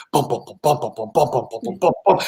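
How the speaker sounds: low thudding bass drum beat; imitated bass drum. Mimicking a documentary's shark music, low drum beat. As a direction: pulse out steady drum thumps. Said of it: "bass drum beat, just going".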